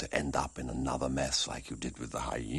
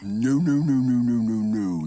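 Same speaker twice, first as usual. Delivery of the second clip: loud mock refusal; sing-song loud no's; loud teasing no; 8 semitones higher, 9 dB louder than usual. Uncle refusing loudly.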